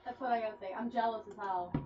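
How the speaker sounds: normal talking voice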